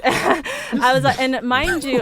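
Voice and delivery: can't believe it, half laughing. Real, not acted. Retelling the pilot's threat to a friend, laughing.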